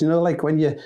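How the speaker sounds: normal talking voice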